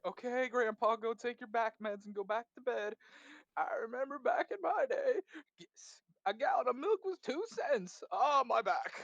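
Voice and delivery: a hint of creaky old grandpa rambling; mock grandpa. Teasing an absent grandpa, lightly mock old-man voice. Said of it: "It's like".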